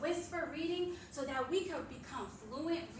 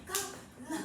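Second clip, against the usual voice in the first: ordinary delivery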